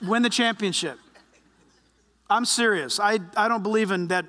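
normal talking voice

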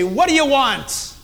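put-on harsh snap at child. Shown as a demonstration. Adult turning on a child, harsh. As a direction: crush a child with an exasperated demand. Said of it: "you think about the tone".

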